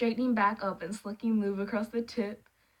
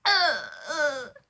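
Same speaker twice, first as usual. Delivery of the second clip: cringing groans; disgusted groan; 5 semitones higher, 6 dB louder than usual. Real, not acted. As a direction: groan in embarrassment. Reader squirming at text.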